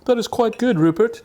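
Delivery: put-on high, plummy English gentleman accent; mock upper-class English accent; posh gentleman. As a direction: play a pompous English gent. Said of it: "I meant to be a gentleman".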